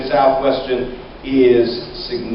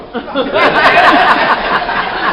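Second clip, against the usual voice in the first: a high burst of real laughter; laughing at own joke; 13 semitones higher, 3 dB louder than usual. Real, not acted. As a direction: crack up at the punchline.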